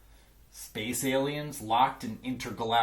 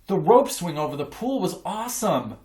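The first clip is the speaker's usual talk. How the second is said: excited; 6 semitones higher, 6 dB louder than usual. A narrator's reading. Kids rave about party.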